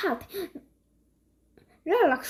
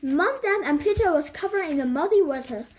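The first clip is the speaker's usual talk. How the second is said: plain reading voice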